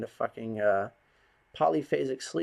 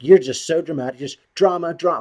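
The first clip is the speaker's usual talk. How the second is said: loud mocking taunt, higher; mocking yell; 6 semitones higher, 12 dB louder than usual. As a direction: throw "drama" at them like an accusation. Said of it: "they'll just be like".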